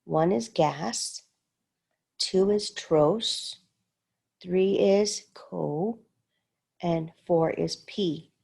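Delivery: slow, syllable by syllable; slow count of syllables; sounding out syllables. Shown as a demonstration. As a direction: break gastroscopy slowly into parts. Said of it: "let me see here".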